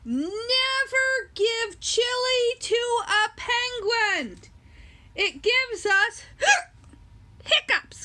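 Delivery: high, slow penguin warning broken by a hiccup. Acted. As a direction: warn the kids, then hiccup. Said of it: "penguin warns, hiccuping".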